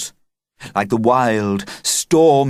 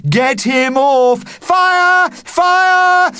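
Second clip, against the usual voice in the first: panicked yelling; 7 dB louder, much slower than usual. Acted.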